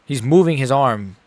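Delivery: ordinary delivery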